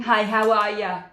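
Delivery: loud Northern Bostonian greeting; Aunt Jackie, Boston accent. Acted. Crafter imitating her Bostonian aunt's hello, Boston accent. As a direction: call it out in a broad Boston accent. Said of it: "your typical Northern Bostonian".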